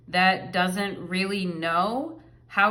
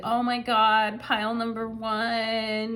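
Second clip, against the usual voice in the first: put-on mild East Coast accent, slow on pile number one; lightly put-on East Coast accent, swooning; 2 semitones higher than usual. Tarot reader voicing the needy partner, mild East Coast accent. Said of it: "I'm talking in an East Coast accent".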